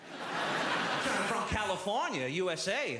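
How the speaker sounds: put-on slow, proud American accent, lightly done. Telling of the drunk American woman, lightly mock American accent. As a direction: drawl out California like a proud American. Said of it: "I can't place your accent".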